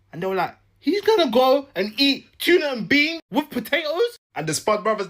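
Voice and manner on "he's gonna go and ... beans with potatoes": high-pitched mock disbelief; scandalised onlooker, voice shooting up; incredulous. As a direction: act out shocked disbelief, pitch way up. Acted disbelief at the meal.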